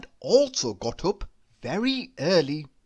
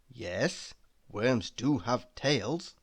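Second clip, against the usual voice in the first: aside; 6 semitones lower, 5 dB quieter, slower than usual. A narrator's reading. Narrator aside to listener.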